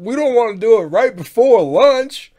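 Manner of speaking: a background voice voiced high and loud; guy in the background. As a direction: shout it from across the room. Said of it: "some guy in the background".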